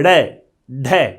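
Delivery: डे, ढे said very slowly with a sharp flapped tongue flick; slow demo of डे and ढे, tongue thrown down from the palate; sound demonstration. Shown as a demonstration. Teacher showing students how डे and ढे are flapped, slowly. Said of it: "यहां पे क्या झटके से आएंगे".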